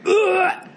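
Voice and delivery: high retching "ugh"; mock gagging. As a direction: heave as if about to puke. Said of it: "[name] was gagging".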